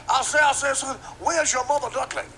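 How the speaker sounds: high, loud cartoon rooster bluster; hammed-up preacher-rooster voice; Sharpton Leghorn. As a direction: boom it out like a blustering cartoon bird. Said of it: "Sharpton Leghorn".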